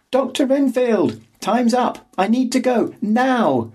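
high urgent calling out; shouting. A narrator's reading. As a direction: call out desperately through door. Shouting through locked door.

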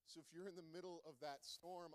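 ordinary talk, nothing marked

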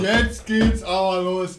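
slow drawn-out mocking bigot. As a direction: drag it out like a ranting bigot.

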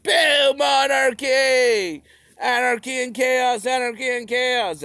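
high loud jeering boo; mock protester shout. Passionate young radical jeering at the monarchy, loud. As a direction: jeer at the monarchy like a hothead. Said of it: "being like".

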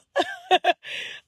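a quick real laugh, high and bright; short high laugh. Real, not acted. Vlogger laughing at the therapist living her dream, short laugh. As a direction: laugh at the irony of it.

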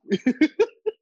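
loud, high laugh. Real, not acted. Co-host after the taxes joke, high loud laughing. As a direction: crack up loudly at a joke.

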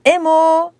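high, loud call for someone. A narrator's reading. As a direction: holler a name, holding the last vowel. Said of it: "diye bağırmış".

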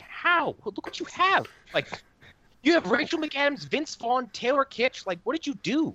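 high, loud incredulous outburst in a mock voice; exasperated disbelief. Ranting at the show's makers about its wasted cast, in high mock disbelief. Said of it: "And you're like".

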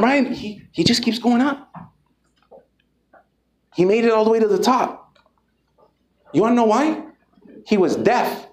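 normal talking voice

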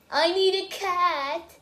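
said out loud, high and slow. Shown as a demonstration.

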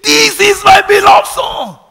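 the father screams over the crowd at the Jordan; screaming. Retold or imitated. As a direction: roar it from heaven so the whole river hears. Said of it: "And he screamed".

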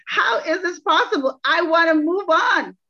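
high, pleading protest; pleading with God. Retold or imitated. Praying to God about taking him back, high protest. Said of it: "And I'm like".

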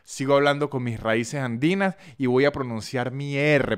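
normal talking voice

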